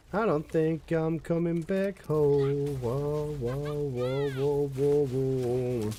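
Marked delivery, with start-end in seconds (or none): none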